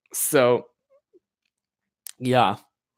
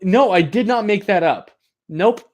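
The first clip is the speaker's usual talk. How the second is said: ordinary delivery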